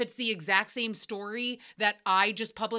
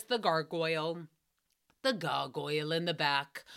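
ordinary talk, nothing marked

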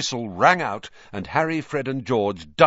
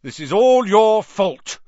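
very loud, slow, higher accusation; furious accusation; 10 semitones higher, 9 dB louder, slower than usual. A narrator's reading. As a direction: drive the blame home word by word. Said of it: "George said angrily to Wood".

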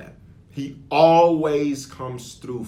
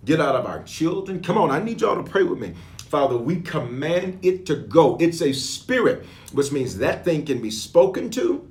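fervent prayer; 4 semitones higher, 3 dB louder than usual. Real, not acted. Pastor leads listeners in prayer against fear, fervent prayer. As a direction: command it firmly in prayer.

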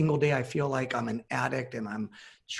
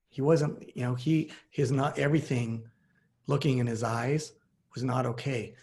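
usual speaking voice

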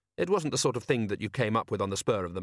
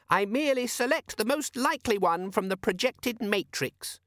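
pedantic squeaky demon; 11 semitones higher than usual. Acted. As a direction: spell it out like a fussy know-all. Demon explains.

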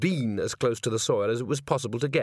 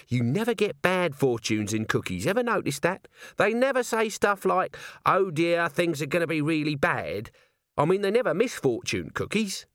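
high, animated character voice; chatty underling with a theory; musing corporal; 6 semitones higher than usual. Acted. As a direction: puzzle over it aloud in a raised pitch.

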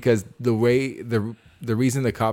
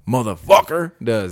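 motherfucker slowed and punched louder; emphasis on motherfucker; 5 dB louder, slower than usual. Shown as a demonstration. Explaining why the word hits, stressing motherfucker. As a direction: lean hard into motherfucker, slowly. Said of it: "it doesn't really have the emphasis like".

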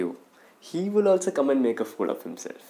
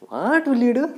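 high, loud goading challenge; mock taunt; 9 semitones higher, 8 dB louder than usual. Owner daring his dog over the phone, taunting. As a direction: dare them in a raised, teasing pitch.

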